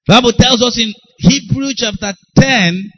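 usual speaking voice

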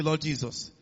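usual speaking voice

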